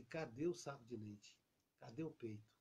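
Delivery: put-on puzzled baby. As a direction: wonder where the milk went, hushed. Bebê procura o peito. (bebê confuso, voz baixa e intrigada)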